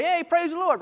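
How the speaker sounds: high, mock-joyful cheer; acted-out cry of joy; joyful shout. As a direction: pretend to rejoice, pitched high. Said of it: "they shouted with joy".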